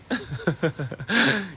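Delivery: breathy amused laugh at himself; a soft, real laugh. Real, not acted. Podcaster laughing at his own catchphrase, soft laugh. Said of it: "Ya empecé con mis frases célebres".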